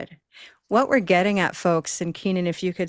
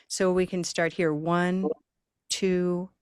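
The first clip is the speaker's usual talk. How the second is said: ordinary talk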